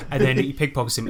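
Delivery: ordinary talk, nothing marked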